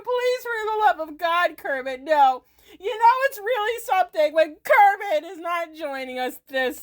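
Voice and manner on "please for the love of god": ordinary talk, nothing marked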